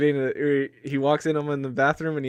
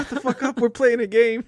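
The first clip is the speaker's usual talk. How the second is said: high mock shout; character snapping at a big guy over a game; mock yell; 9 semitones higher than usual. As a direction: bark it like a bratty kid. Character yelling at big dude.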